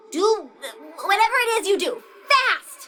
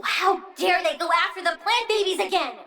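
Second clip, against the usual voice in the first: usual speaking voice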